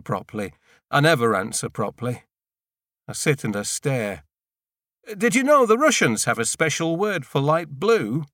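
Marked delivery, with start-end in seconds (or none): 5.2-8.3 higher, louder voiced question; lifted character voice with an eager curiosity; character voice, musing. A narrator's reading. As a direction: ask it brightly, as a different person. Narrator voices the Russian question.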